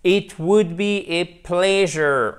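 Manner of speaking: lent, posé, en anglais soigné; model English reading. Shown as a demonstration. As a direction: lire la phrase anglaise en modèle. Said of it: "Voyez cette forme conditionnelle ici". (lecture modèle en anglais)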